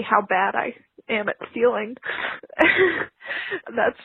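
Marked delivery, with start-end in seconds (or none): 2.0-3.6 breathy embarrassed laugh. Real, not acted. Caller laughs at herself.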